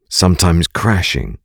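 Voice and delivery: ordinary talk, nothing marked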